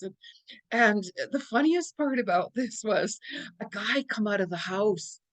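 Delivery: ordinary talk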